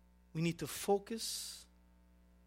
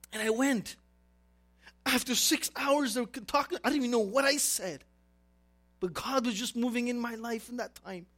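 ordinary delivery

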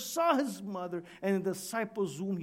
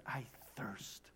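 barely voiced, low and faint; quiet agony; 7 semitones lower, 15 dB quieter than usual. Jesus in agony on the cross, faint words. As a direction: let it drop to a faint low utterance. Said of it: "words of agony".

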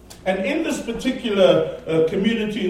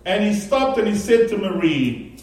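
ordinary delivery

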